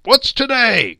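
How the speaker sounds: high, loud eager call; excited hollered question. A narrator's reading. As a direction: shout to someone in the street below. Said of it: "calling downward to a boy in Sunday clothes".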